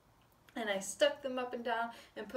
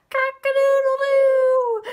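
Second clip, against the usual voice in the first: high, loud crowing with a held last note; rooster crow; 19 dB louder, slower than usual. Acted. Flustered fowl woken in the barn, loud crow. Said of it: "who crowed".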